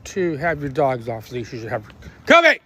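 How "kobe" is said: loud, high shout of a name; yelling the dog's name. Real, not acted. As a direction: yell across the field for the dog. Yelling dog's name mid-sentence.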